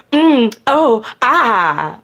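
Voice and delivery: high, loud acted moan; breathy staged moan of pleasure. A narrator's reading. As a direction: let out a hammed-up moan. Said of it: "I moan".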